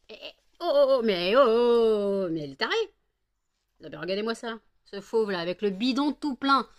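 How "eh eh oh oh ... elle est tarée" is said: startled loud protest. Real, not acted. Dog fight again.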